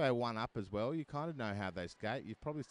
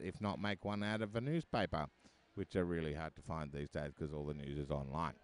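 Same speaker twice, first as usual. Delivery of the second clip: ordinary talk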